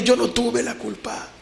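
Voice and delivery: a mild retold sob through the words; llorando amargamente. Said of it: "Y llorando amargamente". (imitando un llanto amargo, más agudo y lento)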